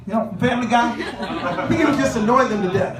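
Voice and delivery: ordinary talk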